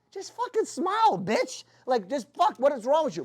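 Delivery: high, drawn-out exasperated plea; acted exasperation. Diners reacting to unsmiling waitress, mock exasperation. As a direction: act fed up, pitched well up.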